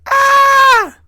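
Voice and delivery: goat scream. Retold or imitated. Goats scream as Billy comes near, a high shriek. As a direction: shriek it out at full voice like a startled herd. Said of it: "they would scream and go".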